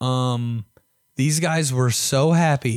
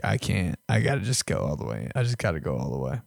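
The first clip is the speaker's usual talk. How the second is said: 1 muttering to self; 8 semitones lower, 5 dB quieter, faster than usual. Retold or imitated. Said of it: "And I'm like".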